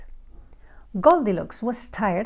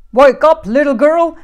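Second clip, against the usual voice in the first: very loud, raised bear roar; growling big bear; 6 semitones higher, 12 dB louder than usual. A narrator's reading. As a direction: roar the order as Papa Bear. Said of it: "growled the great big bear".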